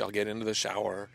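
normal talking voice

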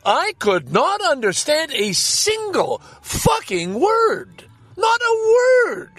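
rising from slow disbelief to a high shouted outburst; exasperated shout. Real, not acted. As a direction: blow up in disbelief at not catching a word. Ranting about the drive-thru speaker.

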